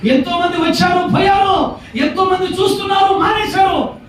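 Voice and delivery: high, shouted lament; impassioned preaching. Real, not acted. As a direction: cry out each item to the crowd. Preacher listing those who deserted. (ఆవేదనతో అరుస్తూ బోధ)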